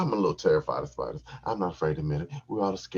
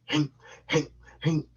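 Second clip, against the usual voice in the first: high clipped hinks; grunting effort noises; 9 semitones higher, 3 dB louder than usual. Shown as a demonstration. Miming effort while thrusting, grunt noises. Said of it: "I, I'm".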